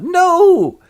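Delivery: high, loud, drawn-out wail; horrified protest. Acted. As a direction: cry out in hammed-up horror.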